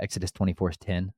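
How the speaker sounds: ordinary talk, nothing marked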